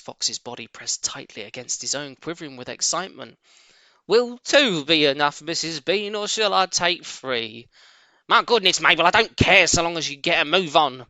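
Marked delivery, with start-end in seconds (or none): 4.1-7.7 a hint of the big servant woman, called up the stairs; lightly voiced cellar maid. Mabel voiced. 8.3-11.1 a touch of the bossy farmer's wife, louder at first; lightly voiced stern mistress. Mrs Bean voiced.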